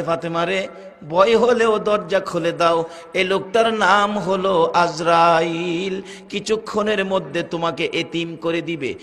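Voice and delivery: usual speaking voice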